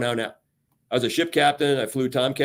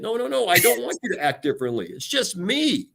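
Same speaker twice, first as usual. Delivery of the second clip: gentle reassurance; 9 semitones higher than usual. Retold or imitated. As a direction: wave it off kindly, drawing out it's just me.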